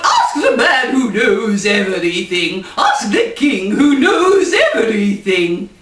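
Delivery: roaring, funny royal voice; a hammed-up queen shouting orders. Queen roars at her servants in a funny voice. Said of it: "She talked funny".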